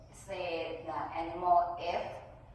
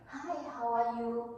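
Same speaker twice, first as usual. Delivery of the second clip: lightly acted greeting, pitched up; higher practice-English hello; friendly small talk; 5 semitones higher, 3 dB louder than usual. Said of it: "You know, American accent".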